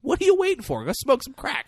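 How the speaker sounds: high-pitched joking sales pitch; hammed-up excitement; mock enthusiasm.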